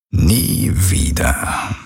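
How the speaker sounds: very slow, breathy murmur; father murmuring a threat into her ear. A narrator's reading. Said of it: "raunte er".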